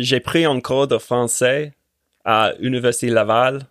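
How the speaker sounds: French with a learner's Quebec-leaning accent; Australian-accented Quebec French. Shown as a demonstration. Giving the host an example on request, Australian-accented French. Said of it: "Tell me about my view in French".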